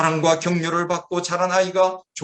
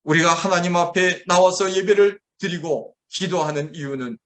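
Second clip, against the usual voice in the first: normal talking voice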